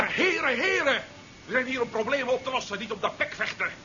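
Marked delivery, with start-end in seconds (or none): 0.0-1.0 loud, high call for order; interrupting two quarrelling men loudly; raised appeal. Acted. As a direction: raise your voice over the quarrel to stop it. Calming a quarrel between officers.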